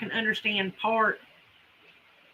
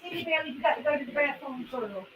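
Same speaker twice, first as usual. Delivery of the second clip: pet talk; 2 semitones higher, 8 dB quieter, faster than usual. Real, not acted. Owner telling dog Belly to go out, soft cooing. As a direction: coo at your dog, a bit fast.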